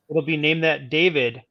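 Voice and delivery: usual speaking voice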